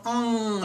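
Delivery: "mm" held as a pure nasal, not a spoken letter N; nasal N sound. Shown as a demonstration. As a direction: hum the "mm" through the nose. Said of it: "but it's the N sound".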